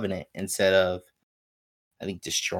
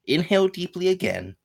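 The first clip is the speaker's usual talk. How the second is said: "Inhale deeply again" read much higher, mock-solemn; mock instruction voice; 10 semitones higher than usual. Shown as a demonstration. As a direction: jump up high on "Inhale deeply again" like a silly manual. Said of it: "and it reads".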